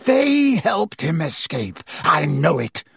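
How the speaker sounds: teacher howls accusation at the minister; howled. Acted. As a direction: accuse them furiously. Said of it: "Snape howled".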